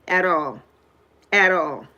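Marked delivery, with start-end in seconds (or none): none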